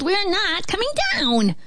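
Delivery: high-pitched piglet squeal. A narrator's reading. Pigs refuse the wolf, high squeal. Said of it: "the pig squealed".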